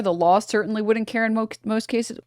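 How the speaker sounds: ordinary talk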